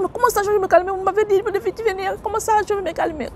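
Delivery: can't calm down, voice shooting up; agitated protest. Retold or imitated. Refuse de se calmer. (protestation affolée, voix très aiguë)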